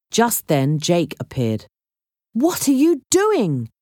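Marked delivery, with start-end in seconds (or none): 2.3-3.7 high, indignant demand; mildly angry, pitched well up. A narrator's reading. Jake catches them with the assistant, angry. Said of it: "he asked angrily".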